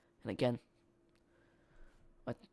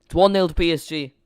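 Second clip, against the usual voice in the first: loud, high announcer shout; goal announcement; 4 semitones higher, 13 dB louder than usual. Real, not acted. Streamer announcing the PSG lead to viewers, loud shout. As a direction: belt out the score like a stadium announcer.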